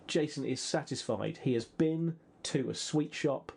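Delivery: ordinary talk, nothing marked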